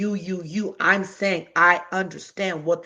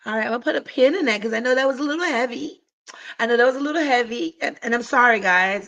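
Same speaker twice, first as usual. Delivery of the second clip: upbeat reset; 6 semitones higher, 3 dB louder, faster than usual. Real, not acted. Lightening mood after heavy point.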